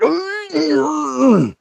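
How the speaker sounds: high strangled groan of pain. Retold or imitated. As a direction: groan like a man doubled over. Arriving at hospital in pain.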